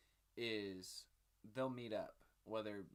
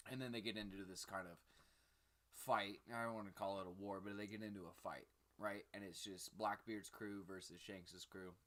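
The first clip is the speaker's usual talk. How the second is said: normal talking voice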